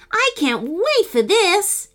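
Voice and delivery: high-pitched, eager cheer. A narrator's reading. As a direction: cheer in keen anticipation.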